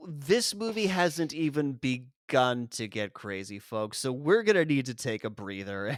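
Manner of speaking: normal talking voice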